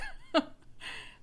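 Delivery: sudden high-pitched embarrassed laugh; a breathy giggle breaking out. Real, not acted. Laughing at herself about flushing in front of the doctor, high giggle.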